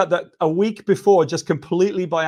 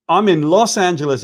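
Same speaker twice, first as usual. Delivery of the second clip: ordinary delivery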